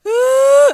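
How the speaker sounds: high, loud mock wail; one long high "ooh" of pretend crying; imitated child's crying. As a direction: imitate a child bursting into tears. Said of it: "she started crying".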